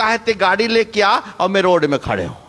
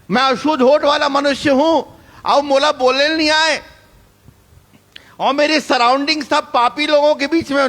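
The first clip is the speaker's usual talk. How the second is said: pleading Isaiah; 8 semitones higher than usual. Retold or imitated. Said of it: "ये बोल सकता था".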